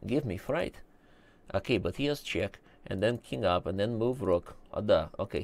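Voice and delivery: put-on soft Russian-English accent, broken grammar; Runglish. Quietly commentating the game in a Runglish accent. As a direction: play a Russian uncle mumbling over the board.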